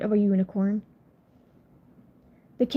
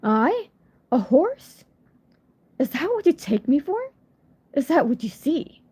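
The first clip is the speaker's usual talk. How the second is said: higher, affronted, quicker questions; offended unicorn, pitched up and pressing; indignant unicorn; 4 semitones higher than usual. Acted. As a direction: bristle at the insult, voice rising. Unicorn offended at being called mare.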